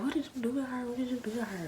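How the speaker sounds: high accusing question; accusing family. Retold or imitated. Family accusing the cousin, high-pitched. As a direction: demand what he did. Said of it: "they was like".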